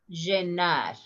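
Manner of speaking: llenar as "zhenar", strong hushing onset; sh-type pronunciation. Shown as a demonstration. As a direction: say llenar with a Rioplatense zh/sh. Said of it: "Listen the pronunciation".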